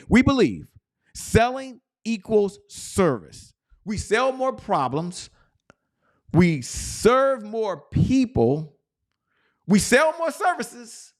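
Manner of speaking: very slow, high rallying chant-like declaration; belief-statement declamation. Shown as a demonstration. Coach repeats the belief statement to the huddle, slow high declamation. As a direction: proclaim each line high, stretched out and separate. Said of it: "let me say it again".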